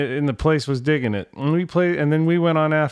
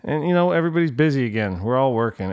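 usual speaking voice

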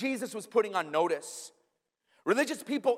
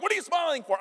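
higher-pitched, incredulous challenge; indignant question; 8 semitones higher, faster than usual. Retold or imitated. Offended people confronting him for smiling at awful news, indignant. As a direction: demand an explanation, mildly affronted. Others react to his smiling.

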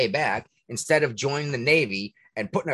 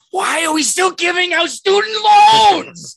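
much higher and louder, slow, outraged; exasperated shout; 10 dB louder, slower than usual. Real, not acted. Ranting to the host about student loans, exasperated shout. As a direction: hurl the question at everyone.

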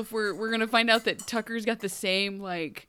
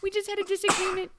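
put-on nervous girl; 10 semitones higher than usual. [name] covering for Peter.

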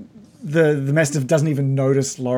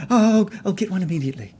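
fast, higher flustered maid; eager maid; 5 semitones higher, much faster than usual. Acted. Maid promising the bandage, fast higher eager. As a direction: hurry to help, a touch flustered. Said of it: "Her voice is like".

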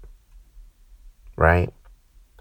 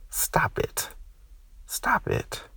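soft unvoiced whisper; whispered plea; 13 semitones higher, 10 dB quieter, much slower than usual. Real, not acted. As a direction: hush it down to a pleading whisper.

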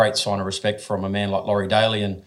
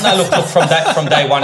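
laughing loudly; 7 semitones higher, 10 dB louder than usual. Real, not acted. Laughing, raised voice.

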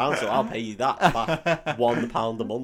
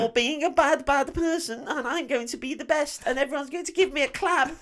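high, squeaky-pitched mock wimp voice; hammed-up high voice, deluded and pleading for applause; mocking little runner; 14 semitones higher than usual. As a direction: send up a little runner's self-important daydream. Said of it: "When he was a little, little runner and he thought".

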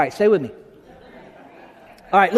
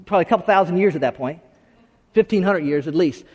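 ordinary delivery

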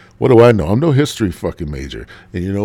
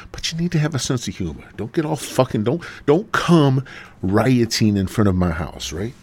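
normal talking voice

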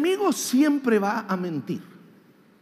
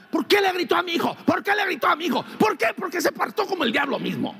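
outraged mother; 7 semitones higher, much faster than usual. Retold or imitated. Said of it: "ahí van".

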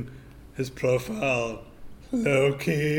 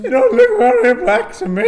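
put-on much higher, loud squeaky kid; excited teen; 13 dB louder than usual. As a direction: blurt it like a cheeky teenager. High teen voice again.

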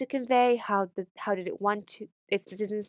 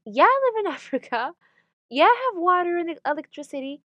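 high-pitched, incredulous comeback with a laugh breaking in; sarcastic, singled-out words swooping high; exasperated retort; 8 semitones higher, 5 dB louder than usual. Acted. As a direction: answer a stupid question with mock patience. Sarcastic retort to ignorant questions.

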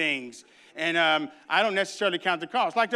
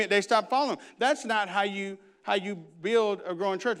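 ordinary talk, nothing marked

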